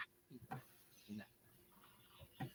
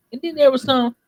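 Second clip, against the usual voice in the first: normal talking voice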